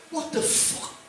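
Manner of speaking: high, breathless shocked cry; barman's stunned yelp at the dwarf; shocked outburst. Acted. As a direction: gasp it out in disbelief.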